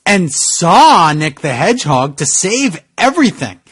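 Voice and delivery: normal talking voice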